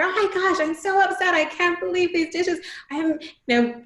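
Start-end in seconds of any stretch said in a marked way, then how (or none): 0.0-2.6 high, loud, hammed-up frustration; exasperated demo. Shown as a demonstration. Parent demoing frustration over dirty dishes, mock exasperated. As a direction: act out being fed up with the dishes, higher and louder. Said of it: "really expressing and articulating".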